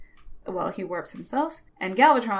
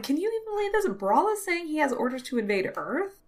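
high-pitched incredulous complaint; acted disbelief; 7 semitones higher, 3 dB quieter, faster than usual. Soundwave telling Galvatron about the troops, incredulous. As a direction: report it as if you can't believe it.

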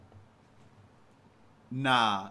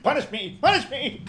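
high, loud hammed-up plea; overacted death scene; 10 semitones higher, 8 dB louder than usual. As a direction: wail it like a dying hero. Mock dramatic plea.

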